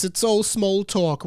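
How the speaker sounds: usual speaking voice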